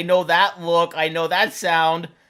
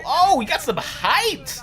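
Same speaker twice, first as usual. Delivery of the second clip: excited; 8 semitones higher than usual. Real, not acted. Repeat of a jump.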